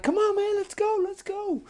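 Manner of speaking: very high-pitched frog voice; excited urging. Acted. Frogs riding on the quad, excitedly urging. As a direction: squeal it like impatient riders.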